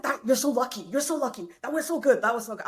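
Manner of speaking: hint of a proud giddy father; giddy dad. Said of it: "he's like".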